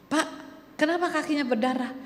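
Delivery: startled by the blood; alarmed clerk. Acted. Clerk notices bleeding feet. (petugas kaget melihat kaki berdarah, cepat dan tinggi)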